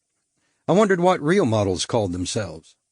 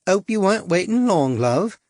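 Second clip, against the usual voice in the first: higher, coy cockney come-on; cockney prostitute; 7 semitones higher than usual. A narrator's reading. Suzette luring the stranger, coy cockney come-on. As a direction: tease him in, sweet and brassy.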